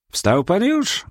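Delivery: high called greeting; calling out. A narrator's reading. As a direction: call across the room. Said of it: "zawołał".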